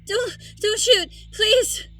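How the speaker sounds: high, pleading cry; panicked begging, voice up high; frightened plea. Acted. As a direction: beg for your life. Vampire begs not to be shot.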